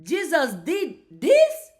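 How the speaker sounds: keen imitator voice, rising high; eager follower. Speaker acting someone who emulates Jesus, eager and determined. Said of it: "you say".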